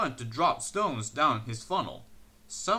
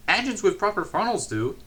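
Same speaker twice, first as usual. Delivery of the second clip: higher, cheeky retort; 7 semitones higher, 5 dB louder than usual. A narrator's reading. Percy teases Henry about his small funnel, cheeky. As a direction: tease him back. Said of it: "said Percy in his cheeky way".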